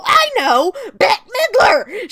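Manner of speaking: drawn-out high delighted cry of the name; thrilled exclamation; excited shout. Real, not acted. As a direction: gush over the name, loud and slow. Gushing exclamation over actress.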